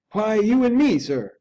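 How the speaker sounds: lightly voiced bold servant; a hint of the plucky butler; undaunted. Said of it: "was the undaunted reply".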